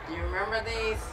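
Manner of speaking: plain reading voice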